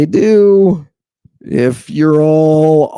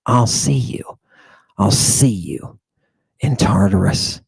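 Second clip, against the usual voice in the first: low taunt; 6 semitones lower than usual. Real, not acted. Lecturer taunts critics with a low menacing threat. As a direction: darkly promise to meet them below.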